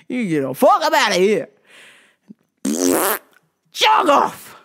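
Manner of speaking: loud, high, indignant telling-off; mock tirade. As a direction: throw them out with comic outrage. Telling the customer off.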